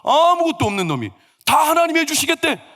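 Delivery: high, loud mock outrage; indignant complaint. Father-in-law complaining to wife, indignant. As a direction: vent it in an exasperated high voice.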